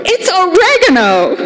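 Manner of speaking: high, triumphant exclaim; excited discovery. Retold or imitated. Sniffing the bag and announcing to friends, high and gleeful. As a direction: blurt it out high with glee.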